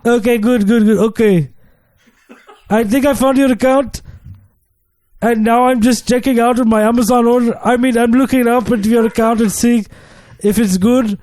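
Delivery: put-on call-center agent in a lightly done African accent; mock African accent, slightly high. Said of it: "I couldn't do my African accent".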